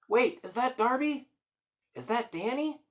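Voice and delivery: high, questioning call; calling out hopefully. Acted. Trapped hero calling out to his rescuer. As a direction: lift each question hopefully.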